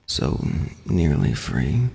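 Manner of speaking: low, slow, dying rasp; weakly choked. Acted. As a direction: choke out the last breath. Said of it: "weakly choked out".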